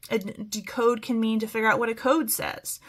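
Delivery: normal talking voice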